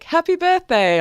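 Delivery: a bright, high, breezy Happy birthday; breezy greeting. Retold or imitated. Casual wall post to him, lightly high and breezy. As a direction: chirp it like nothing's wrong. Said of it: "I was like".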